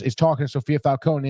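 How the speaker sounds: ordinary talk, nothing marked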